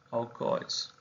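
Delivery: "Alcoholes" susurrado como "aucóis", sin voz; susurro. Shown as a demonstration. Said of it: "os alcoholes". (la palabra repetida en voz baja, susurrada)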